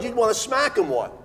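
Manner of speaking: ordinary talk, nothing marked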